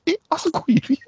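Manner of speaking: spotting her, amazed; surprised. Retold or imitated. Narrator retelling spotting the hostess on her phone, high. Spots hostess hiding in back.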